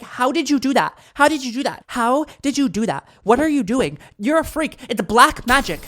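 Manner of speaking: high, loud, incredulous interrogation; hammed-up accusing outburst at a trickster; mock outraged accusation. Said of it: "I, I actually am like".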